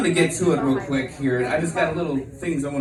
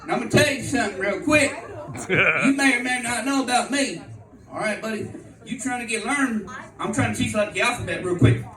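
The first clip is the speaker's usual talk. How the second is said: cocky lecture; 9 semitones higher, 3 dB quieter than usual. Retold or imitated. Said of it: "I was like".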